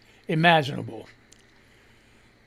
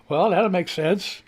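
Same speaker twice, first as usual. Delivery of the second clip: ordinary talk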